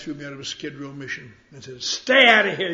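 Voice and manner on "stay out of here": loud, high bark; throwing a bum out the door; shout. Retold or imitated. Mission guys throwing him out, shouting.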